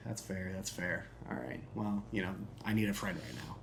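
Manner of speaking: put-on low, soft, downcast boy; subdued, acted dejection; sad. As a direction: confide it quietly, slowing on the last words. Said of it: "I'm, I'm real sad".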